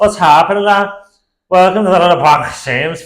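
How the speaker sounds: loud, high attempt at Scouse; Scouse accent. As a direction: put on a shouted Scouse accent. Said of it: "let me hear the Scouse accent".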